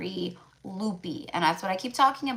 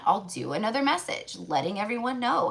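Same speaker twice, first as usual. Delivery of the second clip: ordinary talk, nothing marked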